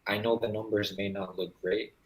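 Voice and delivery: ordinary talk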